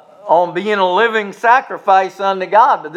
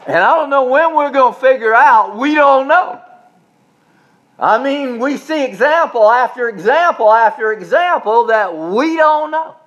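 impassioned preaching; 5 semitones higher than usual. Acted. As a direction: press the point harder each time. Preaching on uncertainty.

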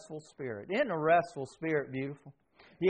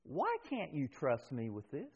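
much softer, gentle asking; Holy Spirit, soft; 3 semitones lower, 12 dB quieter than usual. Acted. Holy Spirit gently asking the believer, hushed. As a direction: ask it softly, almost hushed. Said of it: "the Holy Spirit saying to you".

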